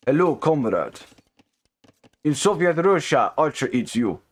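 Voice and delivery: put-on a light, joking Russian accent on a Soviet-style one-liner; hammed-up Russian comrade accent, only lightly done; mock Russian accent. Caster jokes to chat in a mild Russian accent. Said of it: "my Russian accent".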